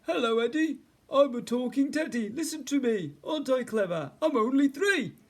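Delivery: put-on high, loud, toy-like child voice; talking teddy. A narrator's reading. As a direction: play a proud little talking teddy. Bear pretends to be talking teddy.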